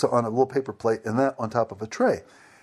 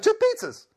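TWO PIZZAS pitched way up; with emphasis. Retold or imitated. Repeating the order to the confused server, exaggerated emphasis. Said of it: "and with emphasis".

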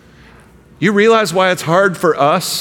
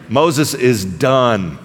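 slow emphatic; 2 semitones lower, much slower than usual. Real, not acted. Repeated punchline.

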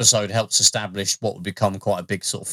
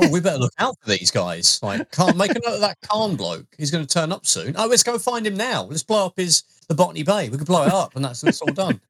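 joking imitation of the crew, much higher; mock crew chatter; 8 semitones higher than usual. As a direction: play the crew scheming in a goofy, high voice. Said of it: "They'll, they'll be like".

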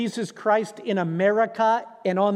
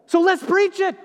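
high, loud call to preach; rallying call; 10 semitones higher, 4 dB louder than usual. Acted. Preacher rallying the church to action, loud. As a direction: rouse the congregation. Sermon climax exhortation.

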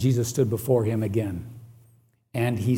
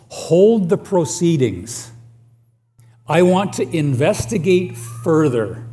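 Pilate halting trial; 5 semitones higher, 5 dB louder than usual. Pretend.

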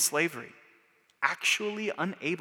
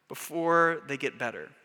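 ordinary talk, nothing marked

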